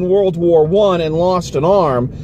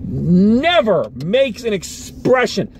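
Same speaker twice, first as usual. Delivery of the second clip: ordinary talk, nothing marked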